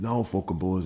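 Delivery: usual speaking voice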